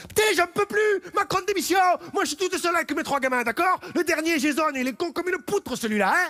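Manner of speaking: fed-up mother ranting at the protest, high and loud; Mireille losing it. Acted. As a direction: shriek like a fed-up single mom at a protest. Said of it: "Mireille, elle serait là en train de péter les plombs".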